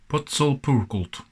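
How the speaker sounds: "Potsolpurkult" slowly sounded out in careful chunks; attempted Hungarian pronunciation. Shown as a demonstration. Presenter retries the Hungarian name after laughing, careful pronunciation of "Potsolpurkult". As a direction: have another slow, careful stab at "Potsolpurkult". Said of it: "I think it's pronounced".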